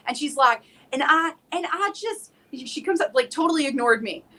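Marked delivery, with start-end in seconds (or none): 0.9-2.3 higher imitation of a Southern lady; Southern accent. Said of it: "this damn Southern accent".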